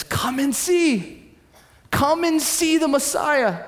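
high, drawn-out call to the townspeople. Retold or imitated. Woman summoning her town to see, calling out. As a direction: call it out high and slow, stretching the words.

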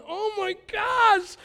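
high, drawn-out shout; exasperated outburst. Acted. Imagined father over his child, exasperated shout. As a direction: cry out loudly, stretching it in dismay. Voices a disapproving daddy's outburst.

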